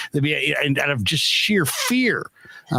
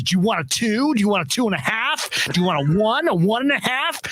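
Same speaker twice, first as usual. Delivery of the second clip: mock angry stylist; 7 semitones higher than usual. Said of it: "getting kinda angry".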